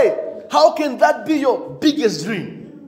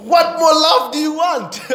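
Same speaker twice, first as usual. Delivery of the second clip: higher, laughing what more love; laughing retort; 6 semitones higher than usual. Real, not acted. Preacher laughing at church members who complain. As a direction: toss it back with a grin.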